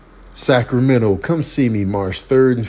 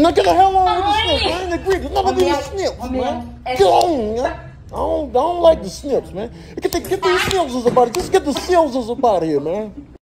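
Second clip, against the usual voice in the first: high-pitched frantic protest; squealing, high objection; complaining character. Pretend. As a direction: whine it high and hurried. Voices character hating snips.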